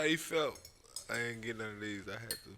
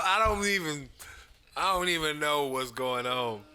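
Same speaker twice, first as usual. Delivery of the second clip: normal talking voice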